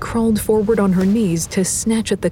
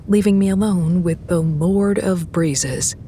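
usual speaking voice

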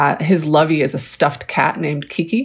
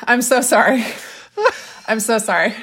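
laughing apology; 3 semitones higher than usual. Real, not acted. Laughing apology after screech.

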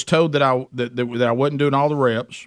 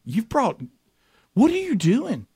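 high, disbelieving what are you doing; mock alarm at being spotted; exasperated; 7 semitones higher than usual. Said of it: "And then I'm like".

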